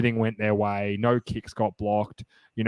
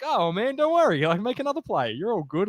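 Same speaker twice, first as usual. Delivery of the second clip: high, bright reassurance, pitched well up; mock reassuring coach; 9 semitones higher than usual. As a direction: comfort the player lightly, half-laughing. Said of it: "he was just laughing".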